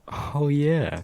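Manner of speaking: high, dopey oh yeah; dazed realization. Retold or imitated. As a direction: sound slow-witted and dazed. Concussed teen remembering injury.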